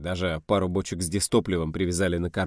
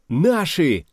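high, loud cry of recognition; sudden jubilant shout; 9 semitones higher, 8 dB louder than usual. Acted. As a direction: yell it out in excitement. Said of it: "крикнул Кузя".

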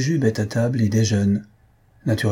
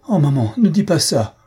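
higher, louder distressed protest; sobbing; 6 semitones higher, 5 dB louder than usual. A narrator's reading. Inconsolable daughter protests to her mother, sobbing. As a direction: wail it with a mock sob. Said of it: "sanglota l'inconsolable Athalie".

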